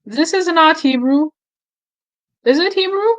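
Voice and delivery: startled double-take at the voice; surprised disbelief. Real, not acted. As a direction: react as the clip sounds wrong. Clip came out wrong language.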